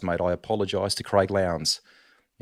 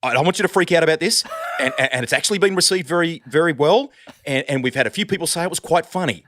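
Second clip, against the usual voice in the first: higher, louder, fast anxious reassurance; imitating a boss rushing to calm him down; panicky producer; 8 semitones higher, 4 dB louder, faster than usual. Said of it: "panic tone in his voice".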